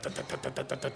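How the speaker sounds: normal talking voice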